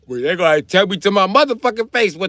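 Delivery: high, loud outburst; indignant yell. Real, not acted. Streamer demanding to know why they want his palm, indignant yell. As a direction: shout it in protest.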